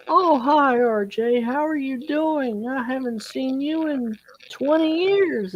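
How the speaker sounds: high, gushing imitation of a former principal; retold squeal of happy recognition; delighted greeting. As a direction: greet a long-lost student in a high, drawn-out voice.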